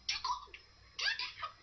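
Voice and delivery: high, small fussy voice; lightly voiced little creature. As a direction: complain like a tiny shivering animal. Character complains in story.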